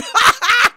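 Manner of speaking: high-pitched burst of laughter; real, helpless high giggle. Real, not acted. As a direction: crack up at your own joke. Cracks up at own joke.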